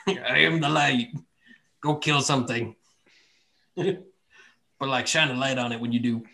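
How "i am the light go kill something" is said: god voice with a mustache. Retold or imitated. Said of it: "the same voice with, like, a mustache".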